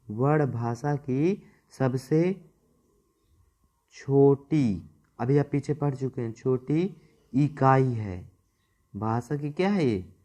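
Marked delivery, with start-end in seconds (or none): 0.1-4.9 slow, word by word, with long gaps; slow dictation. Shown as a demonstration. Teacher dictating the definition to students, slow and spaced. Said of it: "note करते जाएंगे". 6.3-8.3 slow, word by word, with long gaps; slow dictation. Shown as a demonstration. Teacher dictating the definition to students, slow and spaced. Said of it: "note करते जाएंगे".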